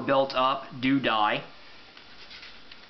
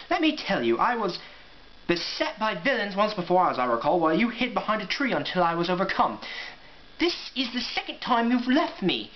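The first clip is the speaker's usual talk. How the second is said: high, prim scolding voice much higher; a plucky young hare maid telling off a coward; 6 semitones higher than usual. Acted. Dottie scolds Ruff for deserting her, high prim scolding.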